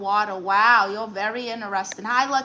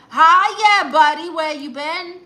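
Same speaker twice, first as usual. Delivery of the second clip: much higher and louder happy hello; excited greeting; 6 semitones higher, 10 dB louder than usual. Real, not acted. Greets a returning viewer she has missed, loud and excited.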